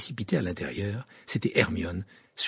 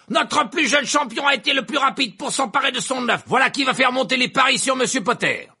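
high, loud stadium announcer; excited announcer; 7 dB louder than usual. A narrator's reading. Verpé announcing Harry's lead to the crowd, booming. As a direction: sell it to the crowd.

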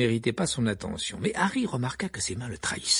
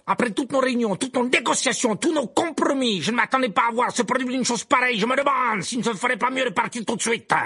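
high, loud, indignant headmaster; Karkaroff flaring up; 12 semitones higher, 5 dB louder than usual. A narrator's reading. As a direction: snap back in outrage. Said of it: "s'emporta Karkaroff". (Karkaroff s'emporte, voix haute et forte)

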